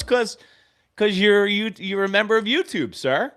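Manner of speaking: high, loud, bubbly hostess voice; mock cheery staffer. YouTube girl explains the free jackets, high bubbly mock voice. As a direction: play her as a gushing, eager staffer.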